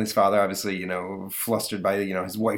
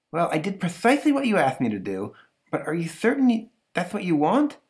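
high, prissy monster voice; prim monster asking Stanley to check its primping; fussy monster; 8 semitones higher than usual. Retold or imitated. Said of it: "monster voice".

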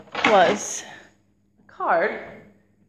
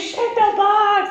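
much higher, slow, drawn-out nag; pestering kid brother; 9 semitones higher, 4 dB louder, much slower than usual. Retold or imitated. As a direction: imitate his endless demand. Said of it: "spent days and days".

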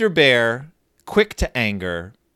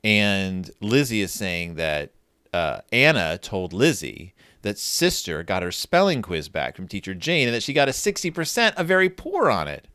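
ordinary delivery

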